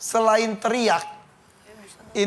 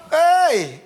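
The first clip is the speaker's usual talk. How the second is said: loud high call; imitated hey; 6 semitones higher, 4 dB louder than usual. Host imitating a contestant's call, loud and high. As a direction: throw a quick hey at someone far off. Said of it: "Tadi Ri Ivo sebut gini".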